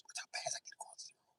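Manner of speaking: menacing whispered threat. Retold or imitated. Inmate taunting his target in a menacing whisper.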